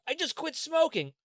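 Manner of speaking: the new quitter shrugging off a punch; cranky quitter character. Acted. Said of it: "I like the character you're doing".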